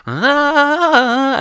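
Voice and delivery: a long, high wailing "Ah" in imitation of a loudspeaker prayer; hammed-up imitation of a call to prayer; mock call to prayer. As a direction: belt out a high, loud, sung "Ah" like a muezzin over a city speaker. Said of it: "All of a sudden, you just hear, like".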